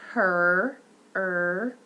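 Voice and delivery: her, then er held tense; tense er demo. Shown as a demonstration. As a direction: sound out a tense er. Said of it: "in the word".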